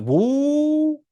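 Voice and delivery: high, drawn-out mock shock; hammed-up alarm. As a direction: pull them up short in exaggerated disbelief. Reacting to Caleb using her name.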